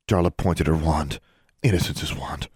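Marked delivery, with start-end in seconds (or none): none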